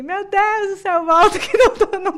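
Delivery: half-laughing mock terror; panicked plea. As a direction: beg the baby to wait. First contraction, panicking.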